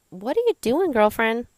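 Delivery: high, loud, sassy challenge; body's scolding voice. Retold or imitated. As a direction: call yourself out as your own body would. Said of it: "signals from my body that would be saying".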